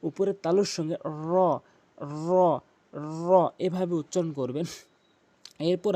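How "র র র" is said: tongue tip turned up to the palate on র; Arabic ra demo. Shown as a demonstration. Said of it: "জিহ্বার আগাটি উল্টিয়ে উপরে তালুর". (র জিহ্বার আগা উল্টিয়ে তালুতে লাগিয়ে)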